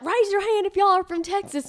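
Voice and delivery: high, loud hammed-up Texan call to the crowd; mock Texan announcer. Texan at fireworks calling to the crowd, hammed-up high call.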